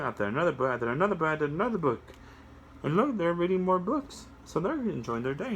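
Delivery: plain reading voice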